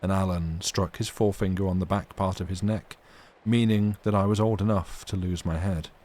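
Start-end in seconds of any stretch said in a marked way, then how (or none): none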